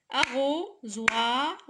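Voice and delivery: "Arrosoir" slowly split as ARRO, SOI, R; syllable by syllable. Shown as a demonstration. Teacher counting syllables for pupils, "Arrosoir" slowly broken up. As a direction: beat out "Arrosoir" slowly with pauses between syllables. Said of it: "trois syllabes".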